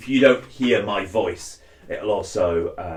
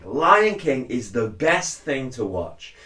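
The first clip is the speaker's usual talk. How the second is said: usual speaking voice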